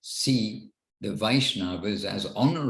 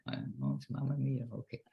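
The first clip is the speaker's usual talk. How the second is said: whisper; 3 semitones higher, 8 dB quieter, faster than usual. Real, not acted. Whispered aside to himself.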